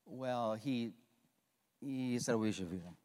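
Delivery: low, soft, reluctant; sheepish admission. Acted. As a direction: confess it under your breath. Disciple admits Jesus' answer.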